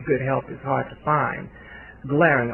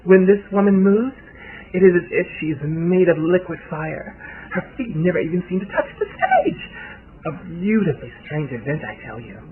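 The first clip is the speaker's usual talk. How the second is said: raised voice, gushing in wonder; adoring socialite; 5 semitones higher, 4 dB louder than usual. A narrator's reading. Socialite describing her idol on stage, adoring and theatrical. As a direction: rhapsodize grandly, dropping off at the end.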